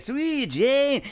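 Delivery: hammed-up [name] imitation, high; mock [name] style. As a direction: poke fun at [name] while singing it. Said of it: "even when he gets to the chorus".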